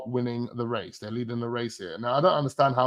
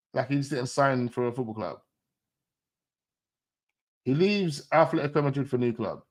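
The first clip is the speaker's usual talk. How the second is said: usual speaking voice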